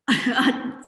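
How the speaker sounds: light nervous laugh; short laugh. Real, not acted. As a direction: laugh it off before answering. Nervous laugh before answering.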